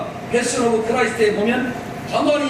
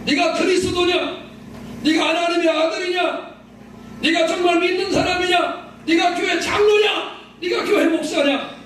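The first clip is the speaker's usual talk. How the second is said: high, insistent mock accusations; pitched-up, acted scornful questioning; jeering challenge; 8 semitones higher than usual. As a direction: hurl each taunt like a heckler. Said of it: "조롱하는 그 강도의".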